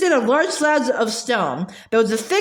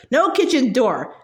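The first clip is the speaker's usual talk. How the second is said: ordinary delivery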